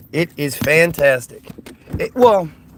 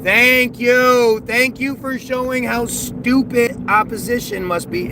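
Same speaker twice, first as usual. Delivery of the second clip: sarcastic thanks; 6 semitones higher, 3 dB louder than usual. Acted.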